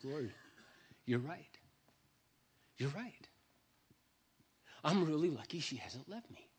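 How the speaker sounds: tearful. Retold or imitated. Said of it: "tears just start streaming down my face".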